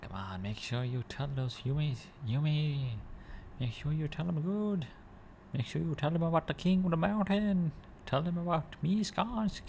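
high-pitched character voice, rising as it goes; put-on game-character voice. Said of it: "I know that's not his voice".

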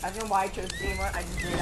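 usual speaking voice

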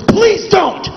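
very high pleading cry; begging body. Acted. His body begging him not to eat, very high pleading cry. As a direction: plead in panic.

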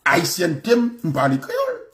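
usual speaking voice

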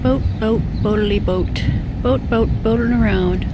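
silly little chant; playful singsong. Real, not acted. Playful chant about a boat.

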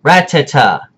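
ratata as RAT-a-ta like Dexter; RAT-a-ta, stress on RAT; Dexter's RAT-a-ta; correct ratata. Shown as a demonstration. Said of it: "The way Dexter said it".

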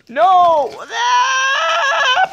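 high, loud scream of panic; panicked scream. Acted. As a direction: scream as it happens. Crying out in panic.

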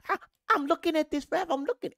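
high, quick put-on voice; mocking quote. Fan quoting someone watching the referee, mocking high voice.

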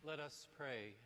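solemn, measured prayer voice; liturgical prayer. Acted. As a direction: pray it aloud to the congregation. Priest prays the collect.

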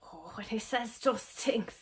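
breathy mock-romantic whisper. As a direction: whisper it like the swooning film heroine. Mimics the whispered trailer line.

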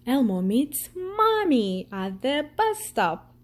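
ordinary delivery, nothing marked

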